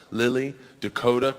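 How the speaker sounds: ordinary delivery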